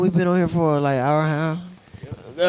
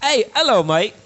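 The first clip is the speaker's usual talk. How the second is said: a put-on, hammed-up British accent, pitched up; lightly mocking English accent; 5 semitones higher than usual. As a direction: play the charming Brit with a fake accent. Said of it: "Accent means everything".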